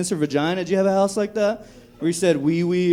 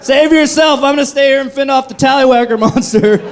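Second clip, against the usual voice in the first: higher, louder hammed-up yell to flee; mock panic shout; 7 semitones higher, 6 dB louder than usual. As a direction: shout the warning like a panicked kid hero. Kid warning sister in mock panic.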